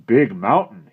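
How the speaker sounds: put-on loud, stern brave scolding the boy; a proud warrior unimpressed by fuss; disapproving Indian brave.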